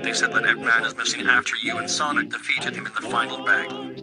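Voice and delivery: caller heard through a phone. Acted. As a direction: talk like a voice on the line.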